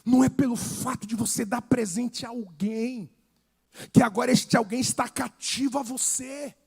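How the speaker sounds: loud, driving sermon delivery; preaching at full force. Acted. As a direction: hammer the point home to the congregation. (pregação inflamada, em voz alta)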